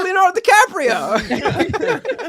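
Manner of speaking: high, slow, laughing put-on Southern drawl; mock Leonardo DiCaprio accent. Mocking his own accent to friends, high laughing Southern drawl. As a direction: hams up the bad DiCaprio plantation-owner voice. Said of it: "[name]'s accents are so bad".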